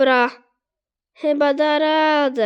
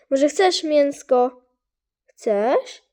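ordinary talk, nothing marked

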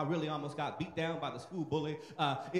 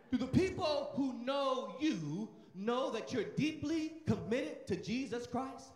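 slow emphatic question; 5 semitones higher than usual. Real, not acted. Preacher repeats question slowly.

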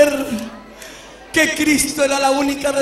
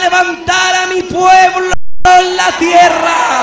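slow, louder commanding charge; voice of the Lord; 3 semitones higher, 6 dB louder, slower than usual. Retold or imitated. The Lord sends [name] to tell His people, slow loud command. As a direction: deliver it as a solemn divine command. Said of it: "Me dijo el Señor".

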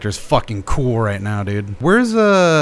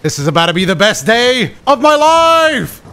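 thrilled; 10 semitones higher, 4 dB louder than usual. Real, not acted. Sees titans pulling a carriage.

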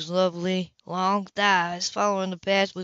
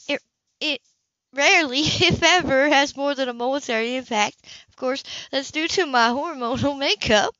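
a lightly voiced woman, higher and flustered, laughing mid-line; flustered heroine; 6 semitones higher, 3 dB louder than usual. Heroine explaining herself to her lover, lightly voiced and flustered. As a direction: play her breathless and trying to stay composed.

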